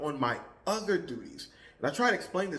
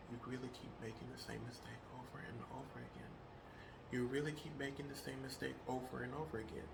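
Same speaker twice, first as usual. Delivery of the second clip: much softer, a bit fast; quiet; 17 dB quieter, faster than usual. Shown as a demonstration. Baseline before raising the voice. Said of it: "I'm just raising my voice".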